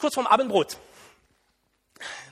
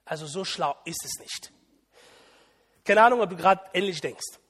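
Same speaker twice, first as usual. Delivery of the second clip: normal talking voice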